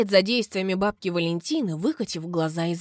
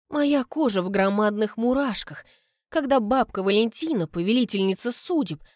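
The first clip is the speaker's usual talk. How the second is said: ordinary delivery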